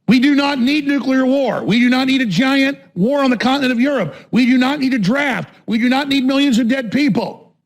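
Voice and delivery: heated, high-pitched declaration; emphatic protest. Real, not acted. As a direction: hammer out each objection. Emphatic repeated refusals of war.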